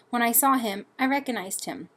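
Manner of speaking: ordinary delivery, nothing marked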